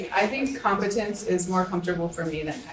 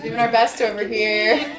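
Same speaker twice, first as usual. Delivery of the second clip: louder, drawn out through a laugh; 4 semitones higher, 6 dB louder than usual. Real, not acted. Board member jokes about the community, laughing. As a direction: say it slowly and loudly while laughing.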